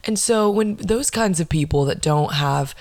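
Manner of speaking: normal talking voice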